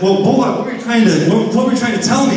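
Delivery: excited plea; high, eager questioning. Retold or imitated. Asking the cat what lesson he meant, high and eager. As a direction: press the cat for the answer. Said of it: "And I said".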